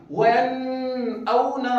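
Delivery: وَيَنْأَوْنَ stretched slowly with the نون held; slow, stretched demo of the mistake; drawn-out wrong reading. Shown as a demonstration. Teacher showing students what not to do, slow demo. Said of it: "اس طرح نہیں پڑھیں گے".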